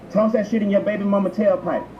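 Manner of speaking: usual speaking voice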